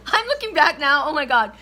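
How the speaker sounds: high, loud, laughing through the words; real laughter. Real, not acted. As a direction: laugh helplessly while talking. Laughs at her own story.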